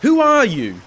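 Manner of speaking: high, loud, alarmed challenge; shrill call. A narrator's reading. Slave calls to the unseen stranger, shrilly. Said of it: "he called shrilly".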